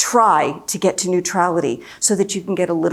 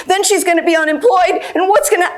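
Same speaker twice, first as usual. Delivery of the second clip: mock panic; 10 semitones higher, 3 dB louder than usual. Voicing her daughter's panicked spiral.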